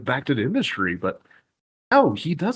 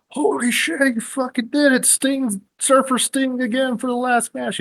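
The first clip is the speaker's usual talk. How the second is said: crowd going wild; 14 semitones higher than usual. Retold or imitated. Crowd thinking Sting returned, shrieking in high disbelief. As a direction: scream it like a stunned fan in the stands. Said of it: "people are like".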